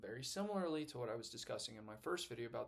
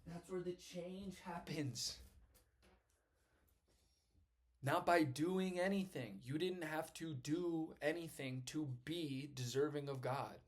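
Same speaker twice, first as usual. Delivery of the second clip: slow preaching; 4 semitones higher, slower than usual. Acted.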